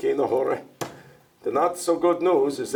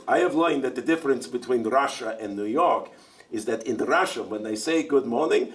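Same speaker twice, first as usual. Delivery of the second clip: ordinary delivery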